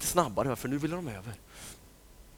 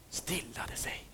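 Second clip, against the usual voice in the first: hushed settling whisper; calm whisper; 14 dB quieter, slower than usual. A narrator's reading. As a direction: let it fall into a whisper. Waves calming.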